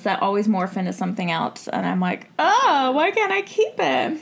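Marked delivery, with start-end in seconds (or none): 2.4-4.2 high, hammed-up complaint; mock dismay. Telling a friend how accents slip away, mock dismay. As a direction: lament it in a pitched-up voice.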